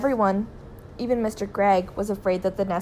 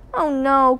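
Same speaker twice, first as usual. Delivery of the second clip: higher, louder exclamation; horrified cry; 5 semitones higher, 6 dB louder than usual. A narrator's reading. Son offered worm burgers, horrified cry. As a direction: cry out in dismay. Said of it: "cried William".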